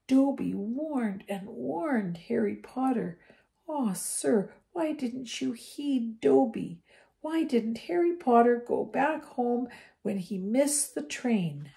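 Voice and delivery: high, slow, hushed elf voice; a tearful house elf pleading with Harry. A narrator's reading. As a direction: beg him like a wretched little elf.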